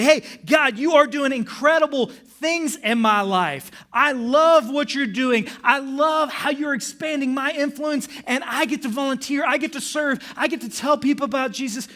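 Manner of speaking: high, loud, joyful praise; amped up. Retold or imitated. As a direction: lift it up to God with full excitement. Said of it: "Man, I get really amped up".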